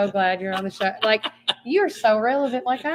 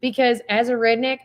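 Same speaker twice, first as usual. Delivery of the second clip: usual speaking voice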